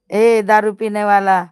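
loud teasing call across the room; a playful loud call to the drinker. Real, not acted. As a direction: shout ए to get his attention. Calling out to a teasing viewer.

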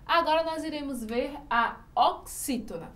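ordinary talk

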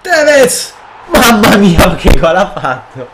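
very high, very loud celebration; real eruption of joy at a great goal; ecstatic goal scream. Real, not acted. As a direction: scream the scorer's name, then marvel loudly.

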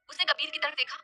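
ordinary delivery

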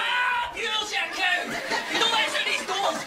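laughing rage at the lift; furious laughing rant. Real, not acted. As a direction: tear into the lift, half laughing. Raging at the lift.

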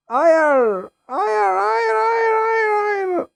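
high, wailing cries of no; desperate no. Real, not acted. Player getting killed, wailing desperate no. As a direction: plead helplessly as it ends. Dying in the game.

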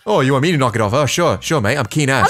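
teasing fake Australian accent; mock Aussie accent. Streamer mimics an Australian guest, mock Aussie accent. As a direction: throw the words back in a put-on Aussie voice. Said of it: "if you wanna sound Aussie".

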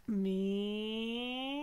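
"Meow" starting low and sliding up; rising cat call from low to high. Shown as a demonstration. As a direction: lift the "Meow" from the bottom upward. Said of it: "from low to high".

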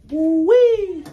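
high loud woo-wee; loud delighted high whoop; excited whoop. Real, not acted. Excited over the puppy.

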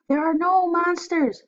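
mother calling up to settle him; called out. Acted. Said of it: "called his mother".

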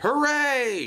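high, drawn-out cheer; shouted cheer. A narrator's reading. Merrick seeing the engines finally move, shouting joyfully. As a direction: cheer out in triumph. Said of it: "shouted Merrick".

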